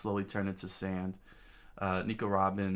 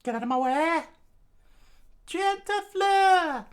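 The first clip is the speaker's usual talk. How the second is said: screamed attack; 9 dB louder than usual. Acted. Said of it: "Nico Robin screams out".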